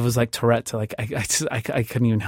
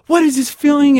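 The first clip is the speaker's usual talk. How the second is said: bawling; 13 semitones higher, 6 dB louder than usual. Retold or imitated. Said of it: "I was bawling like a crazy person and just saying".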